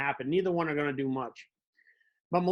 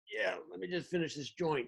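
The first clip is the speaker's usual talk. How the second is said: mumbled stoner reply; 8 dB quieter than usual. Retold or imitated. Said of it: "you're like".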